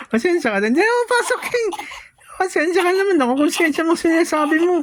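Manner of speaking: high, fast, giggly squeak; squeaky playful voice. Pretend. Father teases the mother in a high, fast, giggly squeak. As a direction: squeak it out, laughing.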